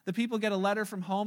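ordinary delivery

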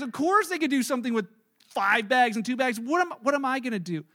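usual speaking voice

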